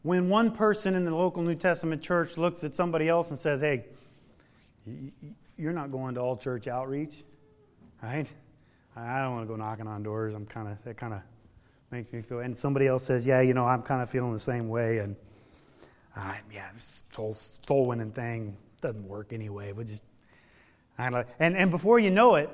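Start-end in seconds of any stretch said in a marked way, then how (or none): 3.6-12.4 timid churchgoer. Retold or imitated. Mocking a fearful church member. 13.3-20.0 hushed, half-whispered excuse; mock timid whisper. Churchgoer dodging soul winning, hushed mock whisper. Mocking a second fearful churchgoer.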